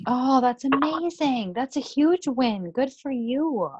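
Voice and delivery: high, slow, delighted praise; excited praise. Real, not acted. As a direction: gush over the good news. Leader celebrating a member's win.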